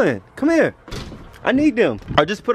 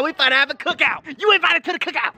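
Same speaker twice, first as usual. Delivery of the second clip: hyped up; 9 semitones higher than usual. Real, not acted. Hyping up the cookout invite.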